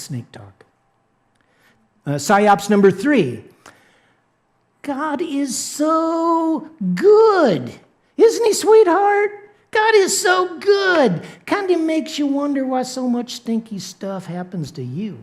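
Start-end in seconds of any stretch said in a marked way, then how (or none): 4.8-15.2 sugary devil. Acted. The devil sweet-talking a believer, high syrupy mock voice. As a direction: coo it like a tempter faking kindness.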